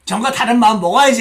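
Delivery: loud mock determination; mock resolve. Preacher parodying forced change of heart, mock resolve. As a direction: talk yourself into it, exaggerated.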